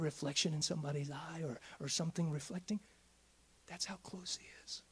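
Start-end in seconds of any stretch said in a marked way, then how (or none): 3.7-4.8 intimate whisper. Acted. Intimate point in sermon.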